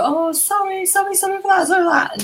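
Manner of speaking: high, sing-song fluttery sorry; mock apology. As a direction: imitate a flustered, overly apologetic guest. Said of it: "we're expecting Marion to go".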